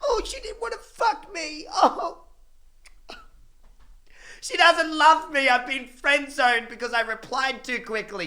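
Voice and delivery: high whining mock crybaby voice; whiny crybaby mockery. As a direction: whine it way up like a sulking kid. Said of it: "you're such a little crybaby".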